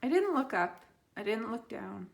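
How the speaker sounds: ordinary delivery, nothing marked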